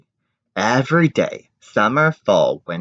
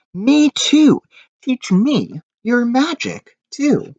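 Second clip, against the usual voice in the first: much higher, louder eager shout; shouting eagerly; 4 dB louder, slower than usual. Acted. Big Anthony running in, shouting to the witch, much higher and eager. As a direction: beg to learn magic, eager and loud. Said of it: "he shouted".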